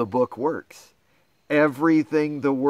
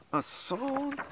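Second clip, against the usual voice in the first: mock dismay; 11 semitones higher, 12 dB quieter than usual. Reader dreads singing.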